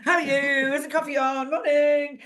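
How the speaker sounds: cheery morning greeting. Retold or imitated. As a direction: breeze into the office all chirpy. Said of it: "goes into an office ... going".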